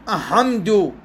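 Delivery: "أحمد" as ah-hamdu, no l; lam merged into ha. Acted. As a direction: swallow the l in "أحمد". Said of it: "دا أدغم اللام بالحاء".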